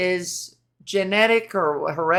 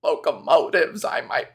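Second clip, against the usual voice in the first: RFK impression; 6 semitones lower than usual. Vlogger imitates RFK for her viewers, low strained croak. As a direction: rasp it out like a voice that sounds like a broken leaf blower.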